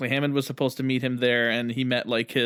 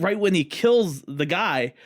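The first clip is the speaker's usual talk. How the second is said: ordinary talk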